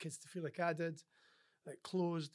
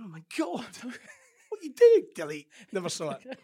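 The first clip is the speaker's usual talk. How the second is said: a slowed, much higher and louder cry of alarm; mock panic; 6 semitones higher, 12 dB louder than usual. Dyslexic man alarmed when people start texting him, in a slow hammed-up squeal. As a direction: squeal in horror at the phone.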